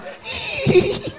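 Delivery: a sudden shrill giggle, way up high; high squealing laugh. Acted. The woman bursting into a high squealing laugh. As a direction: let out a short high-pitched shriek of laughter. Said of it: "then she go to laughing".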